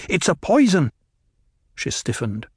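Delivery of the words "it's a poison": poison said high and pressing; urgent warning. A narrator's reading. Paul alarming his mother, high urgent. First take before lowered repeat.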